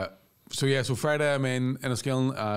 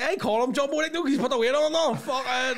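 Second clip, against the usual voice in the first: high, loud Belfast spide; hammed-up Belfast lad; spidey Belfast lad; 13 semitones higher, 4 dB louder than usual. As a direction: imitate a lad noisily slagging his mate. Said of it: "two of the most spideyest Belfast people".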